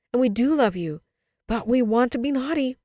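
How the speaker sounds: put-on loud, high, insistent children; cried out. A narrator's reading. Children plead with their mother, crying out. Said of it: "they cried".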